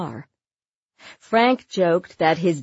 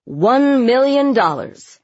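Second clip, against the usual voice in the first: slow, loud, high auctioneer's cry; belted out; 5 semitones higher, 7 dB louder than usual. A narrator's reading. Auctioneer announces a record bid to crowd, belting it out. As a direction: belt each word across the hall. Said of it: "Colonel belted out the words".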